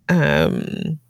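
ordinary talk

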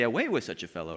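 low, quiet mock-archaic line; mock biblical crowd. Shown as a demonstration. Preacher mocking the biblical wording, low and quiet. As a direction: read it as stiff old scripture. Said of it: "we don't say".

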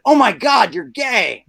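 loud, high shocked outburst; shouted exclamation. Acted. Man shouting the punchline at his friend, loud shocked outburst. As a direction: blurt it out at full volume, pitch shooting up.

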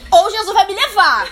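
fervent declaration. Real, not acted. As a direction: preach it with rising passion. Passionate exhortation.

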